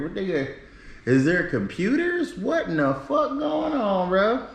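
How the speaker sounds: high, rising incredulous questions; half-laughing bewildered rant, pitched well up; baffled disbelief. Retold or imitated. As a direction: sound totally thrown by the hotel. Baffled by hotel charging food.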